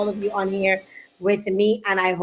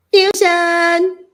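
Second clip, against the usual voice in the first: guest announcement; 6 semitones higher, 5 dB louder than usual. Real, not acted. Announcing the guest onto show.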